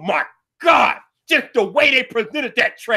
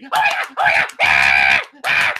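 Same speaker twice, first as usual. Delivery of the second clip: mock crowd frenzy. Mocking keynote crowd cheering the announcement, squealing fans. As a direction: parody fans losing it. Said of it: "the train monkeys went crazy".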